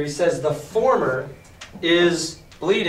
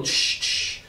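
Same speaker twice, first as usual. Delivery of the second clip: whispered sword clash; 3 dB quieter than usual. A narrator's reading.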